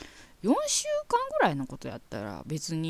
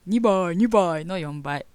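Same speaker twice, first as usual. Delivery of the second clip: louder, slow, drawn-out imitation of the old commercial line; Takamiyama impression; 7 dB louder, much slower than usual. Host imitating Takamiyama's old commercial line, slow and loud. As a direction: do the famous sumo wrestler's catchphrase, slowly. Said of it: "今二倍、二倍ってモノマネしてしまった".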